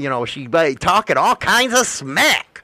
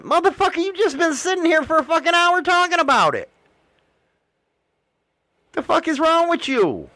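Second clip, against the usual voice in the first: mock exasperated rant; 8 semitones higher than usual. Imagined rant at customer.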